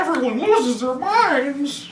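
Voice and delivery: high, slow, laughing clown voice; chewing clown voice. Acted. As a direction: chew the words like a clown. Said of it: "chewing clown voice".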